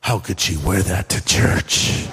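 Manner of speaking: mock judgmental whisper-turned-shout; judgy churchgoer. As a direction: sneer it down low like a snob in the pew. Judging a woman in the pew.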